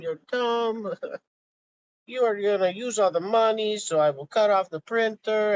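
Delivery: high, loud put-on voice of a ranting schemer; Zeusy impression. Friend mocking Zeusy's rant about Musk and gold, high loud impression. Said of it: "I'm terrible at my Zeusy impression".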